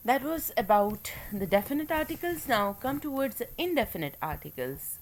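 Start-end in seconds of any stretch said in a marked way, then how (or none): none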